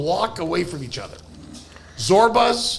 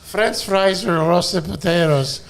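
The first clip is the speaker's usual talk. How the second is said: put-on slow, accented waitress question; Greek waitress; slower than usual. Waitress taking a late-night order, slow put-on voice.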